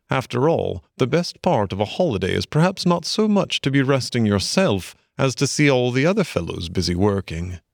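usual speaking voice

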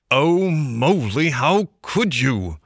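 slow, pleading dismay. Acted. Rat dismayed at Mole, slow and reproachful. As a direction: heartbroken reproach to a friend.

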